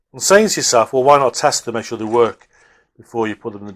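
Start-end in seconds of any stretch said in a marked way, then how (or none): none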